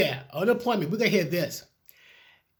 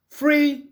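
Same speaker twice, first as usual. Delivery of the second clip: FREE, thrown out higher and louder; scoffing stress; 7 semitones higher, 6 dB louder than usual. Real, not acted. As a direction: spit out the word Free like a joke. Mocking the word free.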